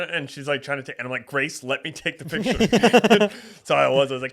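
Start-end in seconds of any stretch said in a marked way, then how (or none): 1.3-3.3 higher, louder and drawn-out plea; retold exasperated insistence. Man begging Grace for the picture, retold exasperated insistence. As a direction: insist loudly, stretching the words, mock exasperation. Retelling insistent repeat after refusals.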